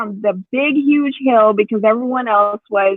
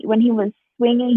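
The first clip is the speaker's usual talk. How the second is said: ordinary talk, nothing marked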